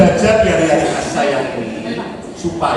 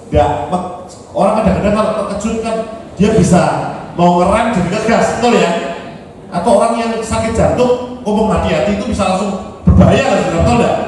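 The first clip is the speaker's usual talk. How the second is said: ordinary talk, nothing marked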